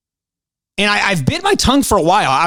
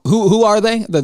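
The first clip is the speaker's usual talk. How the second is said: usual speaking voice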